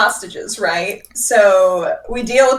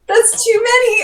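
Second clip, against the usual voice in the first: mock despair; 12 semitones higher than usual. Group horrified at dragon size.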